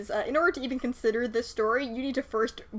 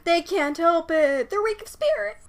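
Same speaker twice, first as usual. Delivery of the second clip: slow, high-pitched mock pronouncement; 7 semitones higher, 4 dB louder than usual. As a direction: declare it in a silly high voice. Continues the mock commoner voice.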